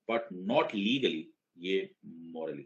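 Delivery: not stressed, then morally dropped low and soft. Shown as a demonstration. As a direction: hit "not", let "morally" sink. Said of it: "contrast stressed". ("not" पर ज़ोर, "morally" धीमा)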